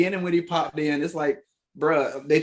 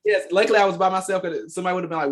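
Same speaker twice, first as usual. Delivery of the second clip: normal talking voice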